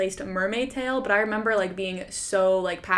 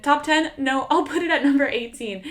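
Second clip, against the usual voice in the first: "Top ten?" tossed off higher and louder, then a breezy "No"; mocking her past self; 6 semitones higher, 3 dB louder than usual. Mocking her old ranking to viewers, airy and higher. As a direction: lightly send up your own old ranking. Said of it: "And I said".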